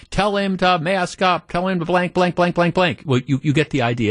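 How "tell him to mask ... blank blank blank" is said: high, mock screaming tirade; retold screaming. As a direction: run of bleeped curses in a raised mock yell. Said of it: "she's screaming at this guy".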